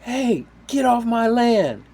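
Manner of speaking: high, loud, drawn-out landowner's holler; slow, high-pitched mock order; mock angry yell. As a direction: imitate someone yelling at trespassers. Landowner orders boaters away.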